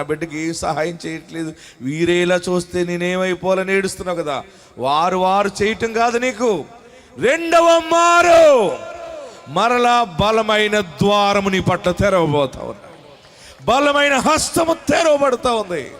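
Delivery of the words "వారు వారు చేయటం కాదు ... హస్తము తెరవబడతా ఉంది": high, loud shouted preaching, slow; full-force preaching. Acted. Pastor promising an open door to the crowd, shouted preaching. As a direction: thunder the promise out.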